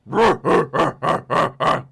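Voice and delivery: loud, pulsing robotic cackle; mechanical laughter. Acted. Game master voices the approaching drone swarm laughing, mechanical and menacing. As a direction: imitate a drone swarm laughing in hard, repeated bursts.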